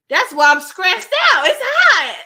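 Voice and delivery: loud, high exasperated shout; overheated outburst. Real, not acted. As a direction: cry out, fed up with the heat. Overheated, exclaims about the heat.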